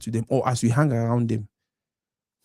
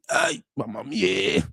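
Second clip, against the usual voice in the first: mock admiring man; 7 semitones higher, slower than usual. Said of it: "why that man pikin go look woman say".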